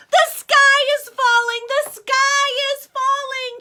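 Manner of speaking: high loud panicked scream. Acted. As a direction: scream it while running away. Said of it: "Chicken Little screamed as he ran".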